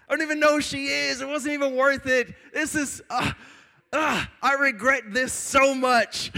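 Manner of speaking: high, loud pleading wail; panicked regret. Retold or imitated. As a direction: wail it like someone begging out of trouble. Panicked regret facing a gun.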